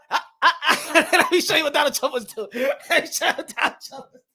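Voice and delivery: real laughter breaking up the words; high-pitched, helpless giggling through the line; laughing while talking. Real, not acted. About to show what Trump did, laughing. Cracking up before his impression.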